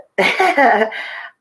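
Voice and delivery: bright high burst of laughter; short real laugh. Real, not acted. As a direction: laugh it off, amused at the cat. Cat wins the argument.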